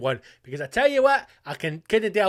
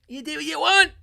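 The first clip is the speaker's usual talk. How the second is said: high exclaimed dismissal; 12 semitones higher, 4 dB louder than usual. Acted. Ranting permission to listeners.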